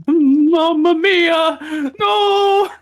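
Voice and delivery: high, drawn-out wail of "no"; loud, theatrical cry of shock; horrified cry. Acted. Finding his own body, horrified wail.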